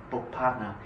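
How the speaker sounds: normal talking voice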